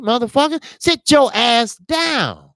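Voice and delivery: a high, drawn-out dismissal; scolding. Real, not acted. Scolding the young boxer.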